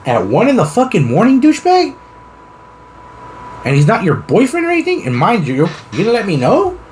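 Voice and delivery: high, incredulous interrogation; mock-indignant questioning, rising sharply; acted outrage. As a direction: play it scandalized, pitch leaping upward. Acts out outraged housemate's interrogation.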